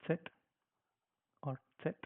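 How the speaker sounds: "Z" said as "tset"; German "Z", ts start and t end; German letter Z. Shown as a demonstration. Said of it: "we pronounce it as".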